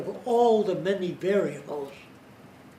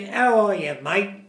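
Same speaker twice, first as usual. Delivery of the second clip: put-on light Australian accent, louder; a hint of an Aussie greeting; 4 semitones higher, 8 dB louder than usual. Lecturer imitating an Australian greeting, light Australian accent. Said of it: "in Australia, he'd say".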